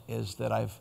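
ordinary talk, nothing marked

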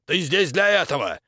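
loud, high-pitched outburst; angry rebuke. A narrator's reading. Baron berates the exorcist he called in, angrily.

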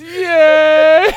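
loud high cheer, broken by laughter; mock loud yay. Imitating dad cheering at failed tree lighting, loud yay. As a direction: yell yay as loud as you can. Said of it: "just loud as he fucking can".

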